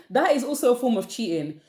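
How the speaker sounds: ordinary talk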